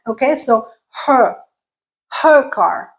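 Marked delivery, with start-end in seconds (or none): 1.1-2.9 R-less British her car; rough British accent. Shown as a demonstration. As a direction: attempt British her car, dropping each R. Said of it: "Not the greatest British accent".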